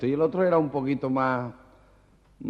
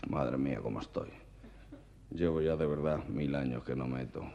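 much lower, much softer tired grumble; weary old dinosaur; 9 semitones lower, 11 dB quieter than usual. Acted. Old dinosaur sighing to himself over the female, low and soft. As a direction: groan it like a worn-out ancient beast.

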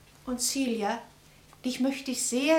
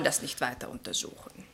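conspiratorial whisper; 7 semitones lower, 9 dB quieter than usual. Acted. Julia hushes the topic to Edward, whispered. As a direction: whisper it as if sharing a secret. Gossip drops the subject.

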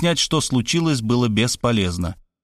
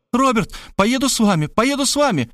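shouting woman; 12 semitones higher than usual. Acted. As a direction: call after him, pleading. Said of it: "кричала".

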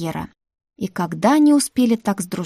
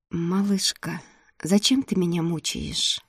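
softer, drawn-out lovesick moan; moaning plea; 6 dB quieter than usual. A narrator's reading. The prince begs Olya in the empty office, moaning softly. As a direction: groan it out like he's suffering. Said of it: "простонал принц".

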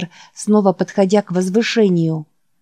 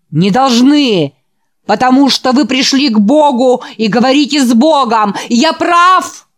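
frenzied preaching; 2 semitones higher, 5 dB louder than usual. Acted. The feverish preacher dances on stage shouting at the boys. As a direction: drive the crowd into frenzy.

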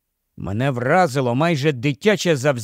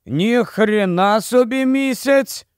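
slow, higher-pitched amazement; mildly dumbfounded; 6 semitones higher, slower than usual. A narrator's reading. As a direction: marvel aloud, stretching the words out. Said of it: "отетеріло мовив він". (злегка отетеріло, протяжно)

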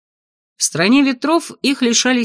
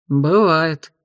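indifferent shrug; 3 semitones lower than usual. A narrator's reading. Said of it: "равнодушно пожал плечами Асти".

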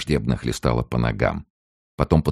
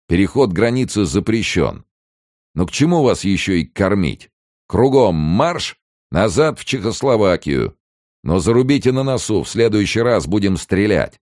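high, raised gendarme's harangue; barked orders; 8 semitones higher, 4 dB louder than usual. A narrator's reading. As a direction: send them marching with a loud warning. Gendarme orders captives back, threatens.

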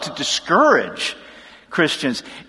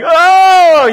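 startled gasp; 8 semitones higher, 9 dB louder than usual. Retold or imitated. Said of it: "they're freaking out".

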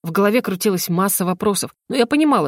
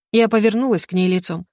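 plain reading voice

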